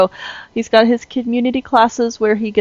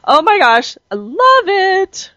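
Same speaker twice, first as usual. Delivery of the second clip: excited; 13 semitones higher, 6 dB louder, slower than usual. Real, not acted. Gushing about new workbook.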